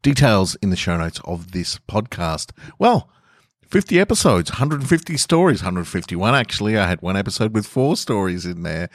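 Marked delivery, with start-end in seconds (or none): 2.8-5.6 much higher, thrilled announcement; excited. Real, not acted. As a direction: celebrate the milestone with real excitement. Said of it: "I'm very, very excited".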